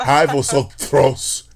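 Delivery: slow, exaggerated Castilian vosotros; mock Spaniard accent. Teasing Spaniards about their accent, mock Castilian. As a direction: drag it out like a mocking Spaniard. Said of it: "talk shit about their accent".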